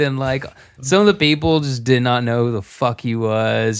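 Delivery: ordinary talk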